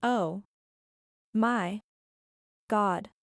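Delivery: "Oh. My. God." with long pauses between words; exasperated, word by word. Real, not acted. Commentator reacting to a new excuse, "Oh. My. God." in exasperated single words. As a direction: set "Oh", "My" and "God" apart, each standing alone.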